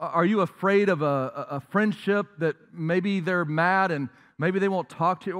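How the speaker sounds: ordinary delivery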